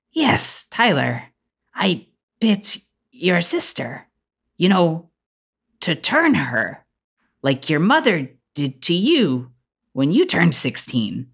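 slow, mannered lady with long pauses; deliberate elegant woman. Acted. Woman explains the bite to Tyler, slow and deliberate. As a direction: spell it out slowly, phrase by phrase. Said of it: "kind of pauses and looks at Tyler and says".

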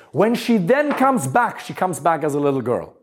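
usual speaking voice

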